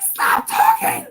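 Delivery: squealed very high and loud, drawn out; hammed-up strangled panic; panicked shriek. Mother silencing her son before police, panicked shriek.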